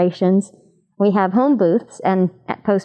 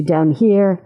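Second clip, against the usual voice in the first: lower placement; 3 semitones lower than usual. Shown as a demonstration. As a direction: voice set low for here. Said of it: "my teenage voice lives about here".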